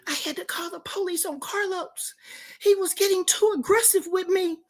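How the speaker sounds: high, hushed, fearful retelling; imitated whispering caller. Caller confiding in a friend about calling the police, whispering. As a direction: play a scared caller keeping it down. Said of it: "What the fuck you whispering for".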